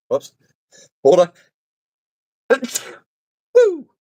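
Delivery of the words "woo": startled woo. Real, not acted. Startled woo mid-recording.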